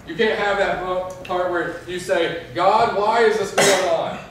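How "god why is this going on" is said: higher, louder, slow plea; crying out to God. Retold or imitated. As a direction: call out your why to heaven. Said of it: "Have that big cry out".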